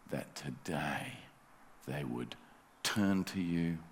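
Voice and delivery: soft, slow, earnest intercession; hushed closing prayer over the new believers; pastoral prayer. Acted. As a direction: lift them up gently to God.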